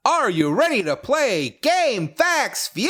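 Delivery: slow booming game show host; drawn-out announcer hype; fighting game announcer. Acted. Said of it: "I like to try and channel Jim Rash".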